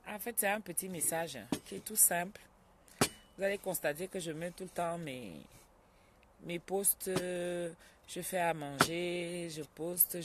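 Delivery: ordinary talk, nothing marked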